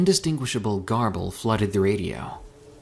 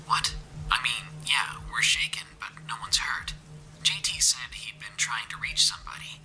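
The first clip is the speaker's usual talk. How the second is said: whispered radio voice; a hostile voice crackling over radio; 7 dB quieter than usual. A narrator's reading. Stranger on the radio telling them off.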